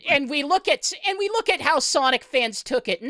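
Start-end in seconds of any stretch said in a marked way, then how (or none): none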